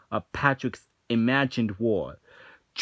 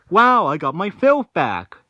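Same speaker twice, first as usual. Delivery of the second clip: higher, louder gleeful character voice; happy Patrick impression; 6 semitones higher, 8 dB louder than usual. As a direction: crow it like a delighted Patrick. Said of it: "and he's like".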